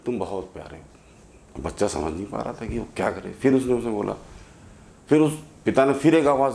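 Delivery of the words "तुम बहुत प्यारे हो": a much softer, slow, faraway repeat of the line; soft echo. Acted. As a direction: let it fade back like an echo off the hills. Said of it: "इको होके दोबारा आई".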